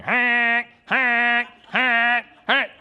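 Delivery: loud high honking frog call; frog honk imitation. Preacher mimics the frog keeping him awake, loud honks. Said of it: "he's loud".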